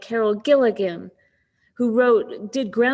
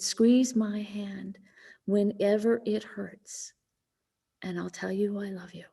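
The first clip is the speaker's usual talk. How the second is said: tender comfort; 6 dB quieter than usual. Retold or imitated. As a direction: gently soothe someone in pain. Daughter soothes mother in pain.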